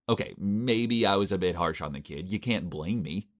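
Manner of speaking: ordinary delivery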